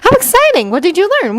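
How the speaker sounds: very high, delighted questioning; high-pitched thrilled interrogation; excited curiosity. A narrator's reading. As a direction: gush with eager interest. Hermione eagerly quizzes Alyssa.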